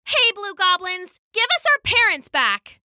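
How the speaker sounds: put-on high-pitched child calling out; defiant little girl. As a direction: challenge them as a brave child. Sasha confronts the goblins.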